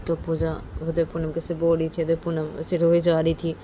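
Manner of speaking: plain reading voice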